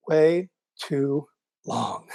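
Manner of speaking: drawn out. Real, not acted. Drawn-out scoff at slow progress.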